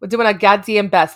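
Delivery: normal talking voice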